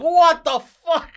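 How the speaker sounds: high, loud, stretched-out shocked cry; waking to a blaring alarm, a long, high, startled cry; startled what the fuck. Retold or imitated. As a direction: drag it out, jolted awake in disbelief. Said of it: "And I'm like".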